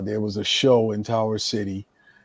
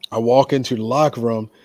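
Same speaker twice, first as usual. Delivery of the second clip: usual speaking voice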